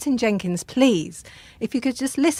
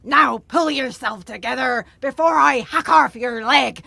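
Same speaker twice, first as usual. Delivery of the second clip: stern sea-dog scolding; pirate voice. Acted. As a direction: lay down the law like a pirate. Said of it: "like a pirate I shall talk".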